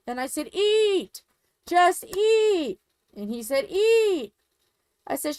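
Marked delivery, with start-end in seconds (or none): 0.5-2.8 high, louder barking order; hammed-up parent's order to eat; mock scolding. Acted parent scolding a kid. 3.7-4.3 sharp, high, louder one-word command; hammed-up single command; mock order. Brother echoes the scolding order.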